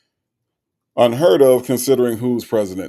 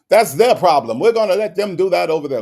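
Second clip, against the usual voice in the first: louder mock British accent, quick and dismissive; 5 semitones higher, 6 dB louder than usual. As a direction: wave it off like a British cop in a bad British accent. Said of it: "my British accent is horrible".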